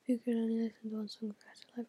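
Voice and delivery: rapid whispered read; fast whisper. Real, not acted. Vlogger reading a viewer question off Snapchat in a whisper.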